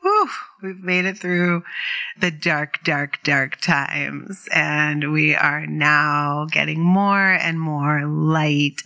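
ordinary talk, nothing marked

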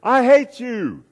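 high, loud, drawn-out holler; angry yell. Acted. Angry boy yells at the echoing voice, angry yell. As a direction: shout it high and slow across the canyon. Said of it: "starting to get a little bit angry".

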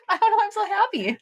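high laugh on happy words; real happy laugh, a little teary; laughing through tears. Real, not acted. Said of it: "You are crying".

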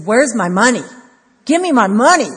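loud, high furious shouting; angry demand. Retold or imitated. Unforgiving servant shouts at the man who owes him. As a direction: bellow it like a greedy debt collector.

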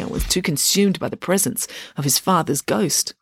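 ordinary delivery, nothing marked

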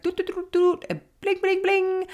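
high-pitched electronic beeping; cartoonish machine bleeps; imitated computer noises. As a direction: mimic a computer lookup with sung-out beeps. Imitating the licence plate lookup.

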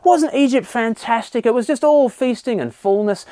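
wistful mock praise of the past; mock nostalgic gushing. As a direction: gush over Egypt like a dreamy grumbler. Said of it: "We conveniently misremember".

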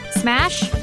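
usual speaking voice

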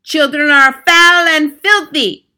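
high, loud, slow thundering. Acted. Grand High Witch thundering at the witches, loud. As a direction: boom it at the hall. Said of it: "thundered the Grand High Witch".

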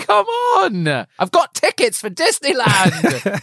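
high mock-frustrated father plea; exasperated father. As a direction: beg them, half laughing.

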